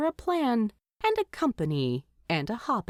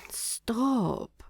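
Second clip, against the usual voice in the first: sleepy mumble; 4 semitones higher than usual. A narrator's reading. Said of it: "It sounded almost like".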